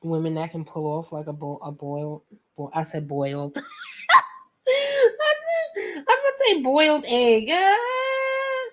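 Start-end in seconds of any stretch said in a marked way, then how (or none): 3.6-8.7 high, loud squealing laughter; cracking up laughing. Real, not acted. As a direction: laugh so hard the words squeak. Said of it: "I'm cracking myself up".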